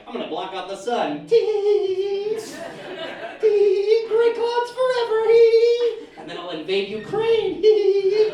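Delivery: high, loud giggling villain rant; wild unhinged ranting. Acted. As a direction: giggle madly between threats. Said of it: "a supervillain out there that's like".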